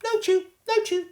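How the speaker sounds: put-on very high, loud little-kid babble; toddler babbling. A narrator's reading. Fudge babbling to his mother about the turtle, high toddler voice. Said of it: "Fudge babbled".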